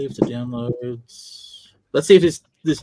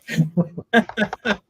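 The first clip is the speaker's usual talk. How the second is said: long, high laugh; 5 semitones higher than usual. Real, not acted. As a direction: keep laughing, pitched up. Laughs on after joke.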